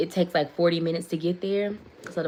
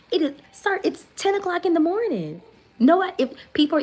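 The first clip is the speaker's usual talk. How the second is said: exasperated; 11 semitones higher, 6 dB louder than usual. Retold or imitated. Arguing with the officer.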